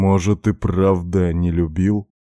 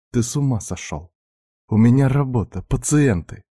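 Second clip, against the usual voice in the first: higher, lightly voiced indignant woman; indignant protest; 6 semitones higher than usual. A narrator's reading. Doctor objects that she has patients, indignant. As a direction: protest in a raised pitch.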